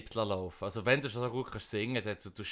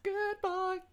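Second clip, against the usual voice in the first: high squeaky praise; dog praise voice. Shown as a demonstration. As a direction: coo at a good dog.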